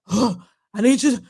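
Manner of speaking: gasping celebrity impersonation; gasp, then start in a husky imitation; lightly mocked breathless woman's voice; Vivica A. Fox. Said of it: "Her voice is deep".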